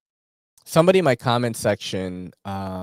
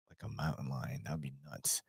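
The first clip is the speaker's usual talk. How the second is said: whisper; 8 semitones lower, 18 dB quieter than usual. Real, not acted. Awed aside about eating predator.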